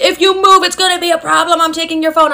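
high-pitched, insistent imitation of dad; mock threatening dad. Dad's threat voiced in story.

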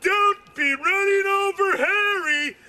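hammed-up cop scolding; mock scolding shout. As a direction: yell the warning like a cop. Cops scolding, retold.

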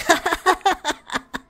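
loud high burst of laughter; cracking up. Real, not acted. Streamer reading an absurd player name, real cackling.

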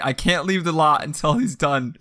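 ordinary talk, nothing marked

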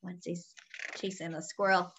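plain reading voice

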